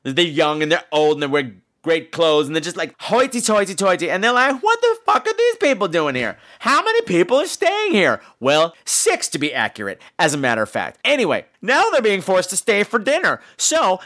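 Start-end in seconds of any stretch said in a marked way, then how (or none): 4.6-8.2 high-pitched mock indignation; incredulous guests. Retelling guests finding strangers in the house, mock indignant. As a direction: exclaim in high disbelief, playing it up. Retold shocked guests' outburst.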